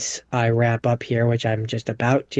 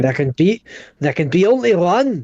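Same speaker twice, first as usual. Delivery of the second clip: louder, higher, lightly declaimed movie line; mock heroic quote; 5 semitones higher, 4 dB louder than usual. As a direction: declaim it with a raised "only". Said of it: "you're saying it like a German".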